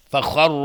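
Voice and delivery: فخر with a thick heavy ر and خ; loud demo with a full heavy ر. Shown as a demonstration. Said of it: "را مفخم هست". (ر مفخم در فخر)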